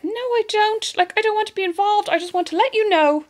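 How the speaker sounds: high hurried refusal; imitating herself on the phone to the guards; mock evasive caller. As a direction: brush off the guards brightly. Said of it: "I'd be like".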